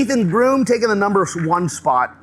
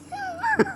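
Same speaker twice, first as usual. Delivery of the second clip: laugh; 6 dB quieter than usual. Real, not acted. Laughs at own joke.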